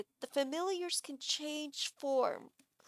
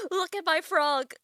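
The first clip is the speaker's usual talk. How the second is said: high, loud gleeful showing-off; excited doting pet owner; proud frog owner; 5 semitones higher, 7 dB louder than usual. Acted. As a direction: show off your darling, loud and high. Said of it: "He's just like".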